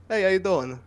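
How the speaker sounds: mild Jersey Italian hello; Jersey greeting. Retold or imitated. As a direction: let the East Coast slip out. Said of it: "just turn around and go".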